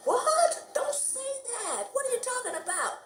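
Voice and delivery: recoiling in comic shock; shocked disbelief. Retold or imitated. To her neighbor, protesting the word, shocked high voice.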